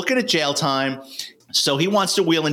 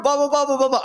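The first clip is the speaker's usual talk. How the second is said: mock chatter; 11 semitones higher, faster than usual. Mocking Tiny's chatter.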